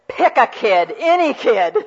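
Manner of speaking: a sarcastic dealer's pitch; mock fed-up offer. Worn-out mother confronting husband behind paper, mock dealer. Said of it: "and says".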